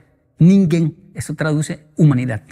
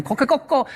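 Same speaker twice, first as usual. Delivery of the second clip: higher, mock Japanese rooster coquecoco; Japanese rooster crow; 8 semitones higher, 3 dB quieter than usual. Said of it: "cacarea en japonés".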